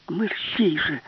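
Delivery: high insistent push; urgent urging. A narrator's reading. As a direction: hurry him along. Alice urges professor. (квапить високим голосом)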